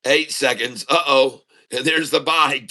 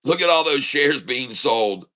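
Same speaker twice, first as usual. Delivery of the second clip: ordinary delivery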